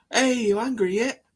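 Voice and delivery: high-pitched goading question; mock taunting. Presenter poking an animal, high mock taunt.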